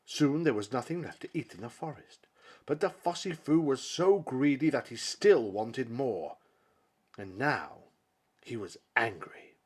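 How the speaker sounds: usual speaking voice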